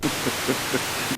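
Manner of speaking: soft, brief chuckle. Acted. General amused by the agent's jab, soft chuckle. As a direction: chuckle softly to yourself.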